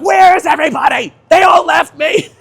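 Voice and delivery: high, loud yell of betrayal; indignant shout. Retold or imitated. Covered in shaving cream, yelling for his missing friends. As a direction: shout it to the empty street.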